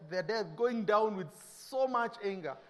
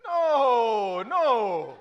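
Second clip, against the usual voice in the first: emphatic no; 7 dB louder than usual. Acted. Preacher rejecting meek reaction.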